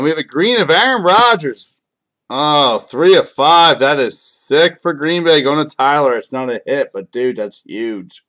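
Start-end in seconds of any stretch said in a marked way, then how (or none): 0.0-6.2 much higher, much louder, slowed on the count; real thrill at pulling a big card; excited. Real, not acted. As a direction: hype the big pull, drawing it out. Pulls a big card live.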